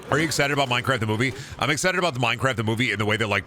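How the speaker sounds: ordinary delivery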